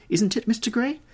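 alarmed high exclamation; duchess crying out. A narrator's reading. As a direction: call out in a high, urgent duchess voice. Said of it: "cried the Duchess".

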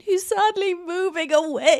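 high sing-song playful sorrow; mock lament. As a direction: wail it lightly, in pretend heartbreak. Mock lament for a departing neighbour.

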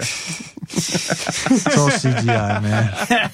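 slow, drawn-out joking drawl; deadpan joke. Real, not acted. Musician joking that the old tape is fake. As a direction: stretch it out as a dry joke.

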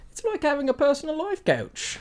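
Margot. Acted.

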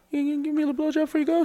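high, soft, very fast beg; imitated meek pleading. To a girlfriend about to leave, meek mock pleading. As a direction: squeak out a timid request.

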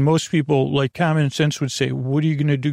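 normal talking voice

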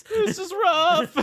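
high, loud, slow whine; Morty. Acted. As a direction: drag out a pitiful high complaint. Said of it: "[name]'s now Morty".

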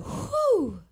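a big high whew of relief; relieved whew. Retold or imitated. Handed a new lipstick tube, relieved high whew.

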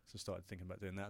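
usual speaking voice

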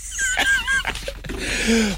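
giddy shrieking giggle; squealing laugh at her own prank; high squealing laugh. Real, not acted.

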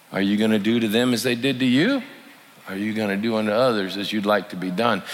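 ordinary delivery

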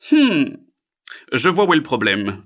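heron. Acted. Heron diagnoses frog's problem, loud character voice. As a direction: pronounce loudly as a learned heron.

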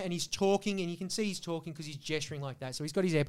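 ordinary delivery

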